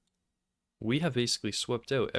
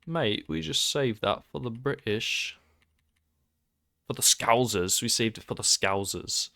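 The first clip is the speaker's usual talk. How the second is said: put-on British accent; 3 dB louder than usual. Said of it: "I just did that accent".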